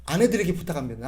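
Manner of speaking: normal talking voice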